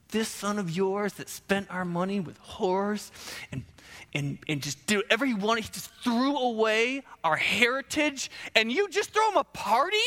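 acted bitter complaint; mock indignation. Older son's indignant complaint.

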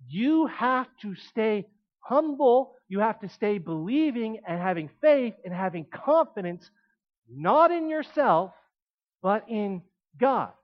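slow, pressing each phrase; emphatic preaching. Acted. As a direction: lean on not in yourself. Preaching the key point.

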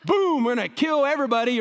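quick hammed-up supervillain boast; mock Thanos rage. Preacher mocking Thanos to show what God is not, in a hammed-up quick burst. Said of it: "God doesn't show up like Thanos".

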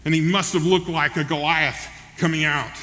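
ordinary delivery

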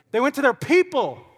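PEOPLE swooping high, then falling; emphatic delivery. Shown as a demonstration. Preacher showing the congregation how to say it, PEOPLE swooping high. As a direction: punch "people" high with relish. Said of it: "you gotta say it like that".

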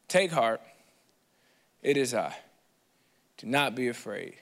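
calm them like a steady hand; tender reassurance. Acted. Jesus' words read solemnly.

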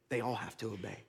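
dropped to a soft, low repeat; quiet echo. Shown as a demonstration. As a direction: let the line sink to a near whisper.

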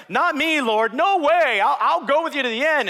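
boasting Peter. Acted. Peter insisting to Jesus he will never fail, loud and high. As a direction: swear loyalty, loud and high.

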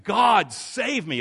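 high, loud plea to God; crying out to God. Retold or imitated. A driver about to crash, crying out to God for rescue. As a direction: call out for rescue, slowly. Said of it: "you're saying".

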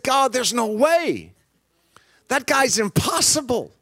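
exasperated protest. Retold or imitated. Classmate retells pleading with God.

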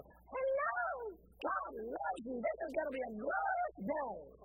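slow, drawn-out singsong greeting with a high hello; mock cheerful secretary. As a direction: imitate an unbearably chirpy morning greeter. Imitating secretary's relentlessly cheerful morning greeting.

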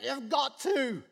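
half-shouted, slow insistence; desperate shouting. Retold or imitated. Official insisting Jesus come, shouting. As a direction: push hard, almost yelling. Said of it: "he's even getting a little bit".

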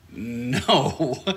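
long, drawn-out wary no; reluctant refusal. Acted. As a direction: stretch it out as a doubtful refusal. Imagined audience's wary refusal.

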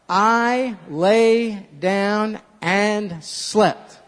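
slow, drawn-out verse; leading the verse slowly; slow recitation. Shown as a demonstration. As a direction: spell it out word by word. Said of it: "Let's say it together".

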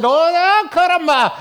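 high, building comic outburst into a loud exclamation; Ricky Ricardo. Acted. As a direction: work yourself up like an exasperated sitcom husband. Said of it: "suddenly he's speaking like this".

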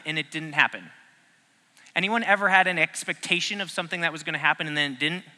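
ordinary talk, nothing marked

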